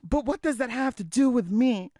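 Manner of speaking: a daughter begging her mom to see; Rita pleading. Acted. Daughter to mother about the choir competition, high and pleading. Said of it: "I'm Rita".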